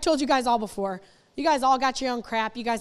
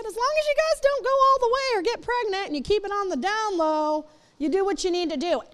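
high, sing-song mock of lax church advice; lightly mocking preachy voice; mock permissive church voice; 7 semitones higher than usual. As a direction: parody the lazy youth leader line.

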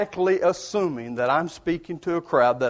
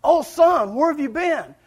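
high, loud anxious mother calling out; mother's voice; 10 semitones higher, 3 dB louder than usual. Retold or imitated. Mother greets her missing son, high and anxious. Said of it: "She says".

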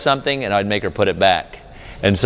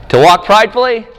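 higher, louder, incredulous on "pridefully"; mock question; 10 semitones higher, 6 dB louder than usual. Shown as a demonstration. Preacher testing the class with a wrong answer, loud incredulous question. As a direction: throw it out as a ridiculous option. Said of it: "No, then you got nothing".

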